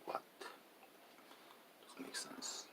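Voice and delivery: a hushed, breathy whisper of a confused Korean; puzzled local. Retold or imitated. Imitating a Korean who doubts his accent, whispering.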